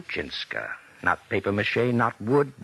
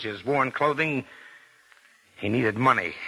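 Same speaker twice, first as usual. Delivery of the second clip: ordinary delivery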